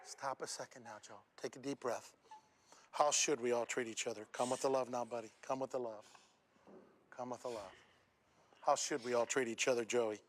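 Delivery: soft, low reassurance; calming. Real, not acted. Speaker calming the crying boy on stage, soft and gentle. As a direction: settle him down gently.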